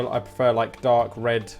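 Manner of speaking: usual speaking voice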